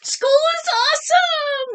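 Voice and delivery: high, loud happy shout; excited shout. A narrator's reading. Clark shouting to his teacher, loud and thrilled. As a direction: shout it out with joy. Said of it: "shouted Clark the shark".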